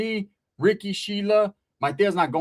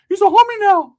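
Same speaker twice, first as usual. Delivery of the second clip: high, loud excited squeal; excited Ricky; 10 dB louder than usual. Retold or imitated. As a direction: squeal it much higher, thrilled.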